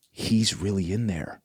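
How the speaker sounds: soft, slow, awed murmur; hushed awe. Retold or imitated. Wife in tears at the tabernacle, hushed and slow. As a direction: say it like a quiet revelation.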